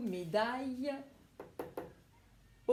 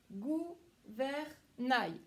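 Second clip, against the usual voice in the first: gouvernail drawn out very slowly; teacher stretching the word slowly; slow stretched word; much slower than usual. Shown as a demonstration. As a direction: stretch gouvernail so each syllable is heard. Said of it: "Où est-ce que tu situes le son".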